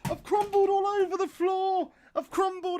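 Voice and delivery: high, loud anguished yelling that eases down; despairing shriek. Real, not acted. Streamer losing the shootout, high anguished shouting. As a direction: wail it like the match is lost.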